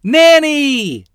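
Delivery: put-on high, loud, eager little girl calling out; eager cry. A narrator's reading. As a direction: call out with delight to her aunt. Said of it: "Lottie said eagerly".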